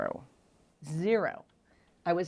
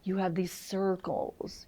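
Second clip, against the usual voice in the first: retold whisper; slower than usual. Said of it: "He's like".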